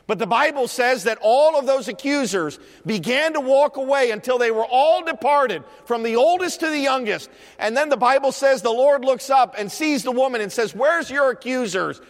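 high, driving Bible storytelling; forceful preaching. Real, not acted. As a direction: carry the story forward with pulpit fervour.

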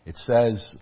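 ordinary talk, nothing marked

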